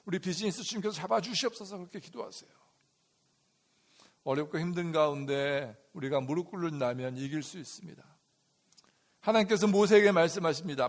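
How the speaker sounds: ordinary talk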